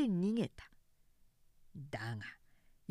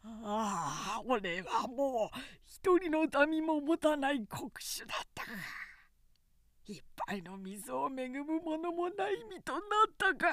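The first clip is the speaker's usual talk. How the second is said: anguished lament; 6 semitones higher than usual. A narrator's reading. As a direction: wail it out, mildly voiced despair. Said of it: "大声で号泣したかと思うと".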